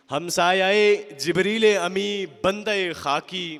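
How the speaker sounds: slow, measured verse declamation; stately Urdu poem recital, swelling on repeated lines; poetry recitation. Acted. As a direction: recite each line with weight, drawing out the key words.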